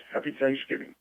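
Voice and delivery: ordinary talk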